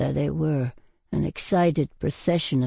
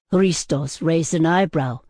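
usual speaking voice

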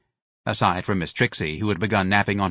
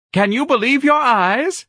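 much higher, louder, slow matron's crow; triumphant matron; 6 dB louder, slower than usual. A narrator's reading. Matron presenting the old clerk to the manager.

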